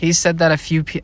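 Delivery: normal talking voice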